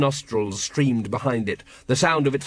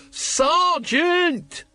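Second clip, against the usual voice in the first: much higher urgent yell; shouting. A narrator's reading. Carrot warning Colon of the dragon, shouting. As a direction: yell to get him to look. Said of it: "when you keep shouting".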